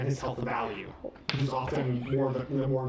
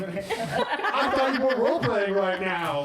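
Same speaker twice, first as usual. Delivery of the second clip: laughing; 7 semitones higher, 5 dB louder, slower than usual. Real, not acted. Laughing at the joke.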